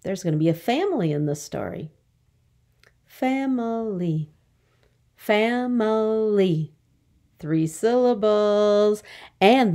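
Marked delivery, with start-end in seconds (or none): 3.0-4.3 "Family" slow, higher, in three beats; syllable by syllable. Shown as a demonstration. Teacher counting for the class, "Family" slowly split up. Syllable lesson, word broken up. 5.2-6.7 "Family" drawn out very slowly, fa-mi-ly; syllable by syllable. Shown as a demonstration. Teacher showing three syllables, "Family" slowly in parts. Syllable lesson, word broken up.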